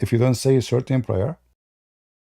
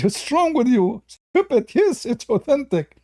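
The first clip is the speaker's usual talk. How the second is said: high, slow scolding "Stupid"; pitched-up mock outrage; mock indignant opponent; 9 semitones higher, slower than usual. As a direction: play him offended and huffy. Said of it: "He said".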